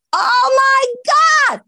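very high, slow, loud shriek of joy; overjoyed yell on the phone; thrilled shout. Real, not acted. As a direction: scream it out like you just got the job. Hearing she landed the commercial.

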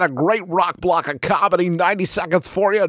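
high-pitched, hammed-up radio DJ patter. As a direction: overdo the announcer reading to a sponsor break. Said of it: "working out my radio voice".

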